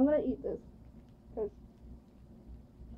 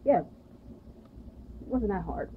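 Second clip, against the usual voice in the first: ordinary talk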